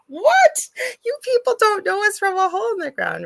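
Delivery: high, loud, incredulous; shocked high exclaim; outraged disbelief. Retold or imitated. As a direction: cry out in disbelief. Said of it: "I'm reading this going".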